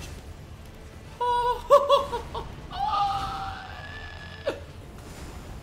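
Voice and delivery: a long high squealing laugh; shrieking laugh. Real, not acted. Failed attack, big laugh.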